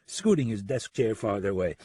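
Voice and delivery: usual speaking voice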